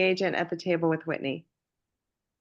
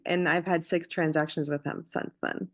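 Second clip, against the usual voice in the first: ordinary talk, nothing marked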